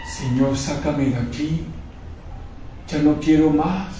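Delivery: slow, quiet begging prayer; hushed pleading, almost breaking; soft desperate plea. Retold or imitated. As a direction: beg God softly to be taken out. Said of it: "y le decía".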